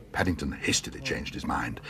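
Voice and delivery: ordinary delivery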